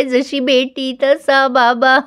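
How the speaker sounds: slow, drawn-out taunt; teasing. Retold or imitated. Kuki teasing grandpa after he bit his tongue, slow taunt. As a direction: draw out each word to tease. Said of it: "cookie आता चिडवतोय".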